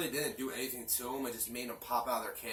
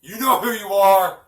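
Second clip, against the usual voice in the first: loud, high mock call-out; shouting at viewers; 11 semitones higher, 24 dB louder than usual. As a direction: yell it right at the audience. Calling out to the viewers.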